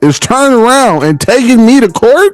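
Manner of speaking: a label head scolding his own artist; label boss. Acted. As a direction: play the record label exec, outraged. Role-plays label boss confronting artist.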